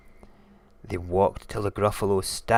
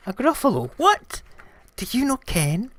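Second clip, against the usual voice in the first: very high, loud astonishment; high mock-shocked mouse; incredulous mouse; 7 dB louder than usual. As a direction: squeal it, amazed anyone could not know. Mouse amazed at snake's ignorance.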